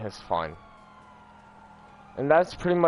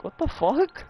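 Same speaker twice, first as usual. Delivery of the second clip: squeaky, very high disbelief; shocked yelp. Real, not acted. Blown up in the game, shocked squeal. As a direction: let it fly up in real shock.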